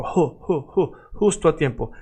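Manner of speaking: ju, justo with a breathy H start; Spanish J. Shown as a demonstration. Teacher showing the Spanish J as an H sound. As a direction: say justo as husto. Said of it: "It's like H sound".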